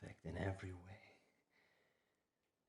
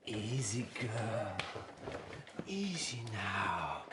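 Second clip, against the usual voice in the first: calming a scared dog; 4 semitones higher, 6 dB louder, slower than usual. Acted. Soothing a frightened girl.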